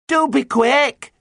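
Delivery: high, drawn-out urgent yell; shouted. A narrator's reading. Pirate hurries the sailors from the lookout, shouting. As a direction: stretch it into a high, loud plea. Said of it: "shouted Peggy".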